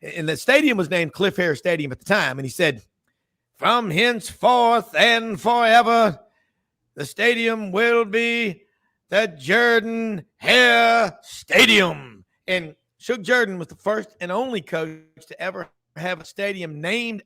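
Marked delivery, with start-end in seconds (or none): 3.6-12.2 slow, loud, raised Southern governor's proclamation; imitating the governor in a grand slow Southern accent. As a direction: drawl it out like a Southern politician announcing from the podium, louder and higher. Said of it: "in that great Southern accent".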